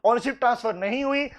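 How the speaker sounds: ordinary talk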